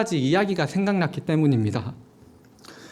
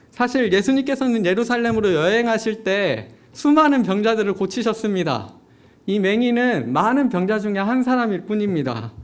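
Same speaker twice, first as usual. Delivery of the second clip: ordinary talk, nothing marked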